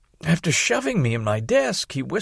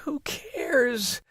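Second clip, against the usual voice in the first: moaning; 12 semitones higher than usual. Acted. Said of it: "I moaned".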